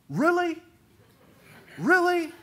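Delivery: high, loud disbelieving cry; John Hardy's "Really?". Retold or imitated. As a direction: ham up the stunned disbelief. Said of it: "as John Hardy would say".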